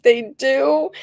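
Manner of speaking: high, rising disbelief; surprised question. Retold or imitated. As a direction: sound amazed and unsure, pitch up. Her surprised reply to doctor.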